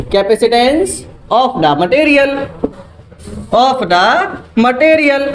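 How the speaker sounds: very slow, word-by-word dictation; slow note-taking pace; dictating slowly. Shown as a demonstration. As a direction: spell out the definition so students can write it. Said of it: "slow dictation of definition".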